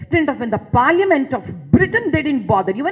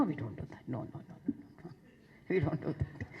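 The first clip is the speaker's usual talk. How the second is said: whispered no; 8 semitones lower, 16 dB quieter, faster than usual. Real, not acted. Denying pujari forcing people.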